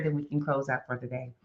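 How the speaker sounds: normal talking voice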